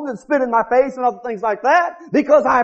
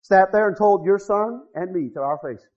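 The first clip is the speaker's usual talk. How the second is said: usual speaking voice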